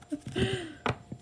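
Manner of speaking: quiet squeaky laugh; soft high chuckle. Real, not acted. Reader laughing at her old breakup, quiet and high. Laughs about being upset.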